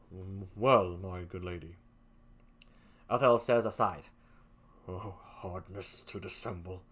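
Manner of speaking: low, soft brooding Othello; Othello aside. Acted.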